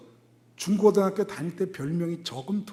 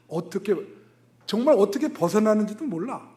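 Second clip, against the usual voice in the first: usual speaking voice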